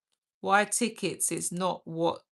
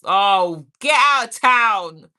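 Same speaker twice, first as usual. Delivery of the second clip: loud exclamation; 9 dB louder, slower than usual. Real, not acted. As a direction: shout it in mock disbelief. Reacts to a teasing comment.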